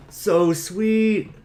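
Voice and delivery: high, loud delighted cry; excited gush. Acted. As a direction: squeal with delight over the gift. [name] gushing over his rabbit.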